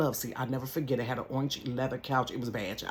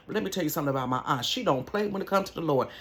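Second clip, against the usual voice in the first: ordinary delivery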